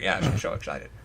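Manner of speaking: low, drawling tough-guy voice; lightly voiced Humphrey Bogart; Bogart impression. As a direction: growl it like an old film gangster. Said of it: "or like Bogart".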